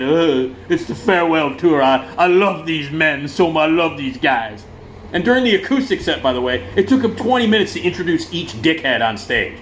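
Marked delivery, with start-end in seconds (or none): 0.0-4.6 drawn-out blubbering declaration of love; imitating a choked-up singer's farewell; hammed-up weepy gush; mock crying. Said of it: "And he's crying".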